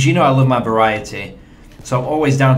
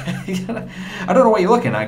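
higher, laughing through the words; laughing denial; 8 semitones higher than usual. Real, not acted. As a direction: laugh it off while protesting. Laughs while denying that he sprays.

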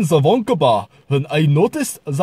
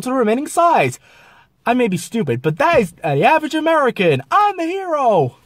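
put-on over-the-top American accent; 6 semitones higher than usual. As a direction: play a brash American, pitched up and boastful. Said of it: "which is a very easy accent".

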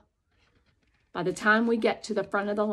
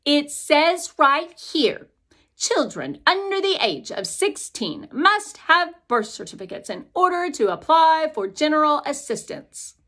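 stiff clerk; 7 semitones higher, 7 dB louder than usual. Acted. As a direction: read the rule out like a bored bureaucrat. Clerk reads the rules sign.

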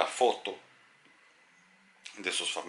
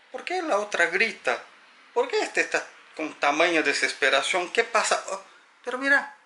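confused ghost; 10 semitones higher than usual. Acted. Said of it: "Mira y dice".

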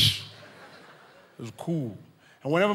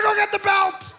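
very high, loud yelling dad; furious dad; 8 dB louder than usual. Retold or imitated. Dad ordering the belt, very high furious yell. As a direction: scream for the belt. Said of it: "I said".

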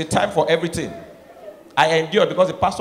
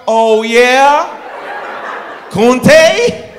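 high loud mocking laugh; mocking wife; 7 semitones higher, 7 dB louder, much slower than usual. American wife scoffing at husband's demand, high loud mock. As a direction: scoff at him. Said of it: "She's like".

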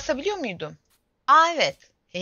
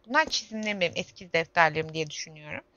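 ordinary talk

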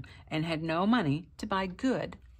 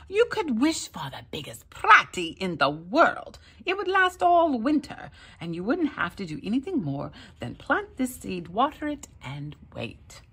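lightly high, louder tempting little man; sly leprechaun; 6 semitones higher, 4 dB louder than usual. A narrator's reading. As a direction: tempt him with the seed. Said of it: "said the leprechaun".

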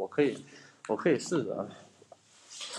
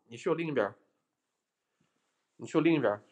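normal talking voice

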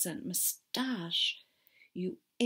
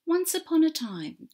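bright, lifted fairy-tale start; storybook opening; 9 semitones higher, 7 dB louder than usual. A narrator's reading.

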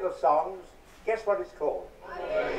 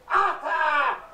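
drawn-out shout; 8 semitones higher, much slower than usual. Acted. Comedian hollering his own name at the crowd. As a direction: stretch the name out slowly and call it.

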